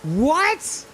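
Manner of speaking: shocked disbelief. Real, not acted. Streamer stunned by a hidden game mechanic, high incredulous cry. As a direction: react like you just can't believe it.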